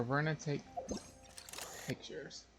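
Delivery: usual speaking voice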